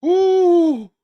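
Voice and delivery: high, loud drawn-out whoop; mock-ecstatic high "ooh"; thrilled "ooh". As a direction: let out a long, high ooh of delight. Reacts ecstatically to the apology.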